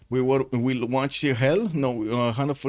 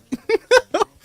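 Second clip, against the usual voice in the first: loud, very high laugh; 9 dB louder than usual. Real, not acted. Streamer cracking up over his game prediction, laughing loudly.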